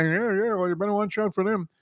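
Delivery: high-pitched mimicked concession; wary retold warning. Fans warning each other about a team, wary. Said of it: "you had to say".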